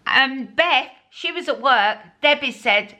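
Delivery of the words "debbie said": ordinary delivery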